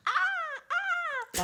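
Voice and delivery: put-on very high-pitched baby dinosaur squeal; short, piercingly high cartoon dinosaur cries; small dinosaur screech. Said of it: "small dinosaur that went like".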